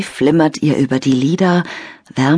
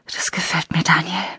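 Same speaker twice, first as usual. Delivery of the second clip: whisper; 6 dB quieter than usual. Acted. Lena whispers into phone.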